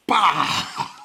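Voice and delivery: loud, high imitated bang; a quick hammed-up gunshot sound; mock gunshot. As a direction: blurt a sudden bang noise.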